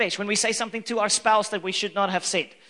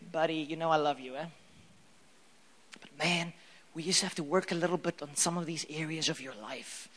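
much softer and lower, tender coaxing; gentle over-coffee correction; nice and gently; 6 semitones lower, 8 dB quieter than usual. Retold or imitated. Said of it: "Let's talk nice and gently".

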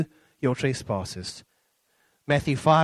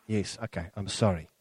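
resigned sorry; 8 semitones lower, 4 dB quieter than usual. Retold or imitated. Giving in to mother.

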